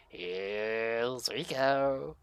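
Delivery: lightly voiced low, slow mutter; a hint of a creepy Shining-style grin; player character line. A narrator's reading. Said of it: "and you do go".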